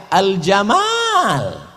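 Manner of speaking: Al-Jamal with a long drawn a; long alif. Shown as a demonstration. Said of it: "itu pakai alif". (Al-Jamal dengan alif panjang, sangat pelan)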